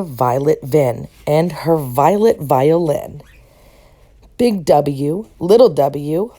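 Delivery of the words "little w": ordinary delivery, nothing marked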